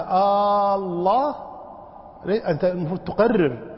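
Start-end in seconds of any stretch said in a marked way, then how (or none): 0.0-1.3 آه held very long, الله swinging upward; prolonged questioning alef. Shown as a demonstration. Teacher shows the wrong lengthening, long alef with questioning rise. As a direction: stretch آه, then raise الله as if asking. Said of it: "فمد هذا".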